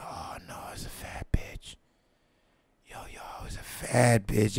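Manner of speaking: horrified whisper. Retold or imitated. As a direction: whisper in mock horror. Whispered dismay at sight.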